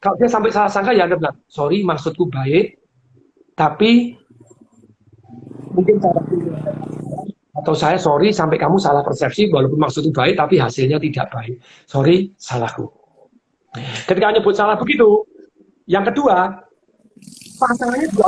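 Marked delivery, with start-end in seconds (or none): 1.4-12.9 tender sorry to a spouse; sincere apology. Shown as a demonstration. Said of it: "nadanya harus tepat, sebutkan".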